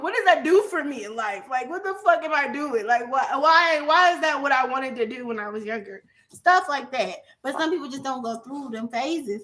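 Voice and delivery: ordinary talk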